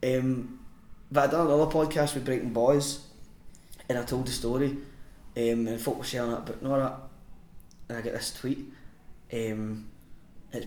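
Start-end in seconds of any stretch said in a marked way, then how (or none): none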